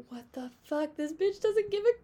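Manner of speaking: rising to a high, loud incredulous cry; shocked disbelief. Retold or imitated. Reacting to the contestant ignoring her bleeding foot, high incredulous shock. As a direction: replay the shock of seeing her keep going.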